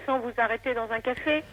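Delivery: ordinary talk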